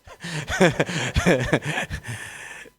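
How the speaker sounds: long, loud burst of laughter; real laughter. Real, not acted. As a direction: crack up at your own slip. Laughs at own mix-up.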